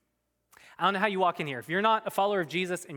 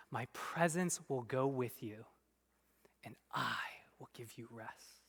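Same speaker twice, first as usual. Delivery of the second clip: soft, fading into a whisper; hushed divine promise; 4 semitones lower, 10 dB quieter than usual. Acted. Preacher voicing God's promise of rest to Moses, whispered. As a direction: breathe the promise gently.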